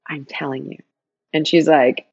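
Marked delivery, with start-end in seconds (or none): 0.1-0.8 low, soft, earnest assurance; quiet insistence. Retold or imitated. To Diana at adoption agency, quietly insistent. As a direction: insist gently, dropping the voice.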